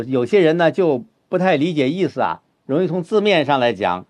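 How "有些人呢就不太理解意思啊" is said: usual speaking voice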